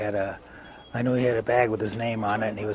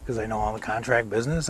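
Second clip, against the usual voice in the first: ordinary talk, nothing marked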